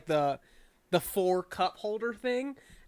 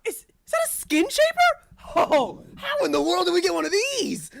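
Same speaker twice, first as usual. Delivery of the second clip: very high, louder amazed squeal; 11 semitones higher, 4 dB louder than usual. Acted. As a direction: gush over a rare find, pitched way up. Anna gasps at a skin shaper.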